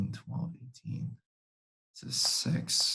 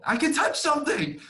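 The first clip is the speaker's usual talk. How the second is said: much higher, thrilled squeal; excited relief. Real, not acted. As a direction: celebrate finally getting it to work. Finally gets screen working.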